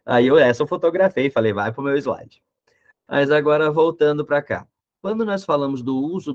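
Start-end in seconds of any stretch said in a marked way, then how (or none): none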